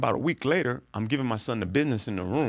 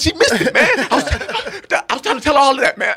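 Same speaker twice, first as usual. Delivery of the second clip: much higher, louder, whiny wail; mock frustrated cry; 11 dB louder, faster than usual. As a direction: wail like the disappointed fan. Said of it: "He goes".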